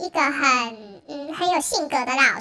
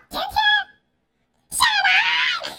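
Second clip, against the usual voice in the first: angry yell; much slower than usual. Retold or imitated. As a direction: bark the order at the student. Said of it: "然后我对他怒吼说". (对学生怒吼)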